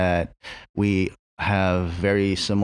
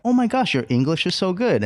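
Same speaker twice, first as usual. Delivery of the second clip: higher, gushing fake-surprised praise; mock condescending customer; 9 semitones higher than usual. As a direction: imitate a patronising stranger, high and bright. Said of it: "they would ask for something like".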